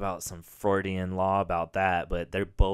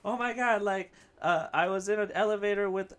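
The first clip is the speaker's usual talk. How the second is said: mock excited survivor; 10 semitones higher, 4 dB louder than usual. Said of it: "they're like".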